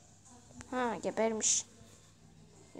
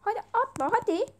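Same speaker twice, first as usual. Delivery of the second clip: loud, higher "Hadi atla hadi"; eager urging; 6 semitones higher, 6 dB louder than usual. Real, not acted. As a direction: urge him on, impatient and excited. Urging player to jump. (yüksek sesle heyecanlı teşvik)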